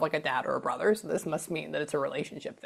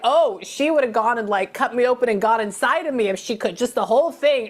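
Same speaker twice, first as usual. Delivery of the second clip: higher, louder imitated outburst; extreme, exaggerated; 7 semitones higher, 8 dB louder than usual. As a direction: retell it as a hammed-up overstatement. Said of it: "he makes it very extreme".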